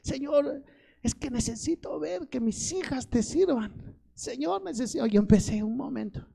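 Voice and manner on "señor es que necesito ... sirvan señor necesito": high, softened plea to God; tearful pleading prayer. Retold or imitated. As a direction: reenact begging the Lord through tears. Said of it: "empecé a clamarle al Señor y empecé a llorar".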